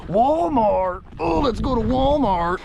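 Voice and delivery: high, loud goofy cow voice; cartoon cow squealing about going to Walmart; silly cow voice. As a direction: voice a dopey excited cow spotting a human. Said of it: "that's what's going through their heads".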